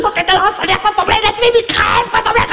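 high, mocking wife voice; insulting wife. Wife insulting her husband, high and mocking.

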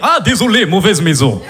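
put-on low laughing Russian accent; hammed-up Russian mobster. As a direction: apologize in a thick Russian accent. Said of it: "Je fais bien l'accent comme ça".

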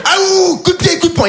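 high, shouty cartoon crocodile voice; squeaky crocodile logo. Comedian voicing his tracksuit's crocodile, high and shouty.

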